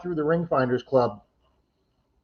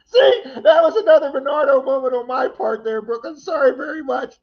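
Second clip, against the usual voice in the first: laughing apology; 11 semitones higher, 4 dB louder than usual. Real, not acted. Laughing apology after a gaffe.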